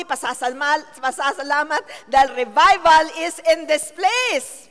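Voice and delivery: loud, high shouted praise, stretched out; preaching at full force. Acted. Preacher shouting praise to the congregation, full force. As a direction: rouse the whole church to shout.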